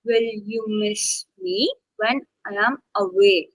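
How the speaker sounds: slow, leaning on miss, me and away; stress on miss, me and away. Shown as a demonstration. Teacher modelling the question for the student, slow with stress. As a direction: read it slowly, landing on miss, me and away. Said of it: "only two stress words. Three".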